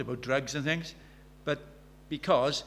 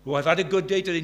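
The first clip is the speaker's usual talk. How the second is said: mock smugness; 4 semitones higher, 10 dB louder, faster than usual. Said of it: "self-satisfied boast mocked".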